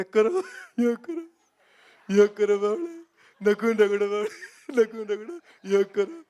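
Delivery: chanting yoga guru, loud and drawn out; Baba Ramdev impression. Ramdev teaching the crowd yoga, loud chanting guru voice. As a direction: lead yoga like Ramdev, stretching the words. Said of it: "जैसे बाबा रामदेव".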